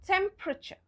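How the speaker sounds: TEMPERATURE in three syllables, tem-pruh-cher; silent E. Shown as a demonstration. Teacher models the correct way, TEMPERATURE as tem-pruh-cher. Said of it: "We pronounce it as".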